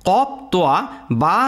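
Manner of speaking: normal talking voice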